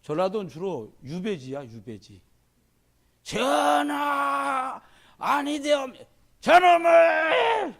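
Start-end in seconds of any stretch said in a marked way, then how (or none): 3.2-7.8 high, loud pleading cry rising to a yell; courtier's desperate shout. Acted. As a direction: cry out to the king as if your neck is on the line. Acted courtier protesting to king. (임금에게 목숨 걸고 외치는 신하의 고함)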